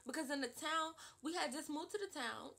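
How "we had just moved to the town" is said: ordinary delivery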